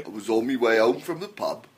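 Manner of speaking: put-on terrible British accent. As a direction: tell it in a hammed-up bad British accent. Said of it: "some of the worst accents ever".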